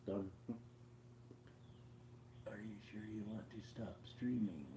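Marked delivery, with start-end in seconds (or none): 2.5-4.6 breathy whispered read-out; whispering. Real, not acted. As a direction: whisper it to yourself. Reading phone prompt aloud.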